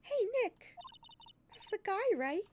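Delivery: put-on excited young Maya urging Nick on; Maya's voice. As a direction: pitch way up as the eager sidekick. Voicing Maya.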